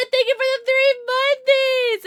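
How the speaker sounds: high, slow, stretched-out gratitude; excited thanks. Real, not acted. Streamer thanking Fawn for three months, high and drawn out.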